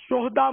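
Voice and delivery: شہداء said as shoh-daa in two syllables; vowelless ha. Shown as a demonstration. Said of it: "ہاں کو ساکن پڑھنا".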